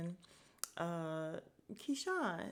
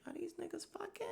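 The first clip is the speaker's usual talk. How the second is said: high, incredulous whisper-quiet question; 12 semitones higher, 4 dB quieter than usual. Retold or imitated. Manager eyeing the two men, high soft disbelief. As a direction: squeak it out, shocked and nosy, softer. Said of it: "the look was like".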